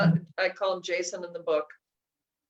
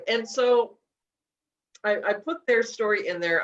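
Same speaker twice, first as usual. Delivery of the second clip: usual speaking voice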